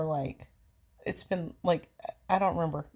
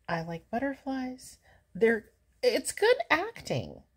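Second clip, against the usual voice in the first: ordinary talk, nothing marked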